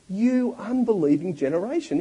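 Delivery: exasperated. Retold or imitated. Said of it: "Jesus is exasperated".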